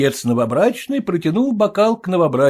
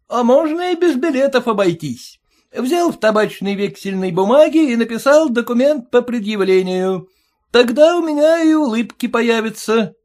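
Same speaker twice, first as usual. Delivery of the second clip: a high, pinched voice, louder, needling the father-in-law; mocking groom; 10 semitones higher, 4 dB louder than usual. Groom taunting his stingy father-in-law, high and mocking. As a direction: jeer at him in a sing-song. Groom demands a promissory note.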